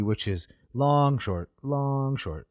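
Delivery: long, short, long, short, tapped out; stressing each long syllable first; trochaic rhythm. Shown as a demonstration. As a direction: mark the trochee beat. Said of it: "like such".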